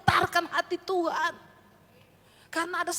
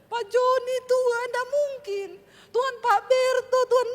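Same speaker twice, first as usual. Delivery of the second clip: crying out names to God in a pleading wail; a pretend wife weeping over her husband, pleading; mock tearful prayer; 6 semitones higher, 7 dB louder, slower than usual. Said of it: "tangisi suamimu".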